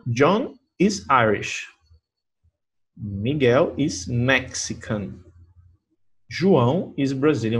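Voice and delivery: slow, careful teacher read; slow model reading. Shown as a demonstration. Teacher reading example sentences slowly for students. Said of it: "reading examples again".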